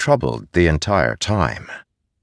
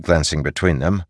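plain reading voice

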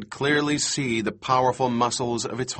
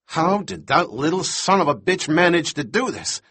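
mildly raised, higher angry voice; angry outburst; 5 semitones higher than usual. A narrator's reading. Doctor rages about the stowaway kid, angry outburst. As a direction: fume in exasperation. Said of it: "Thorne turned purple with anger".

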